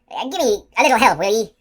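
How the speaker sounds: loud plea. A narrator's reading. Giant asking for help.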